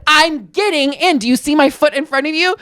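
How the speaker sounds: high-pitched mock exasperation; exasperated retort. Man protesting to Diane that he obeys, exasperated.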